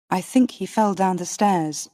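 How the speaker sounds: ordinary delivery, nothing marked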